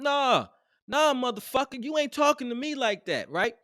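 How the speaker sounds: normal talking voice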